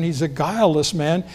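ordinary talk

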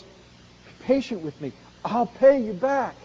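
slow, drawn-out plea; begging. Retold or imitated. Fallen servant slowly pleading with his fellow servant. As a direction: stretch the words out, pleading. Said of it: "This man falls down and says".

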